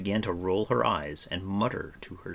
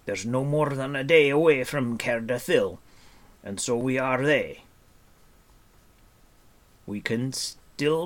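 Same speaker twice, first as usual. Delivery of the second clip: grouchy, higher-pitched dwarf voice; testy character voice, pitched up and pushed; snapping dwarf; 6 semitones higher, 6 dB louder than usual. A narrator's reading. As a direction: scold them impatiently as a cross dwarf. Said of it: "snapped the dwarf".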